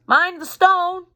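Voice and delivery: slow, high called warning; calling out. Acted. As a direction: call a warning as you throw. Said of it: "the boy called".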